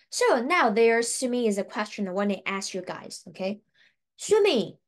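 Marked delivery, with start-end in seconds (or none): 4.2-4.8 calling out. Real, not acted. Calling out to viewers.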